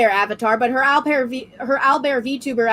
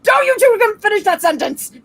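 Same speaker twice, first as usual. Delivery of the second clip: mock warning; 8 semitones higher than usual. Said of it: "I'm like".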